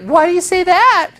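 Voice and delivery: put-on high girly voice, curious; girl voice. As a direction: squeak it like a surprised girl. Girl's reply in his story.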